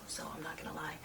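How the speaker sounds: confiding whisper; whispered aside. Real, not acted. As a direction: drop to a secret whisper. Confiding aside about afternoon.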